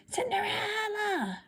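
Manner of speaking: high, drawn-out shrill call down the hall; calling stepsister. A narrator's reading. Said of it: "her evil stepsister called".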